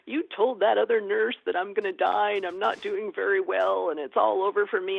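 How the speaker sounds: imitating an upset, accusing patient; retold sobbing. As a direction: wail it lightly as a hurt patient. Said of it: "He's absolutely crying hysterically".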